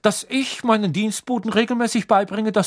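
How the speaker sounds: cold fury. A narrator's reading. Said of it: "mit kalter Wut in jeder Silbe".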